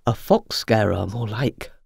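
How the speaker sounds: a lightly voiced grand cockerel; booming cockerel. A narrator's reading. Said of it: "boomed Cornelius".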